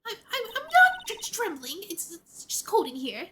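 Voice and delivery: high, loud nervous stammer, easing off; trembling denial. Acted. Rookie lawyer denying nerves to a colleague, trembling stammer. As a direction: stutter through a defensive protest. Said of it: "Keep trembling like that".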